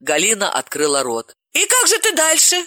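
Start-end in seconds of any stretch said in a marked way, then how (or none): 1.5-2.6 very high, breathless question; eager question. Acted. Galina eagerly asks Dalila about her plans, very high. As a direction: ask it on the edge of her seat.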